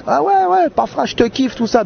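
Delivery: very high-pitched mock fan gushing; excited fan imitation. Young fans hailing the comedians in the street, mock excited.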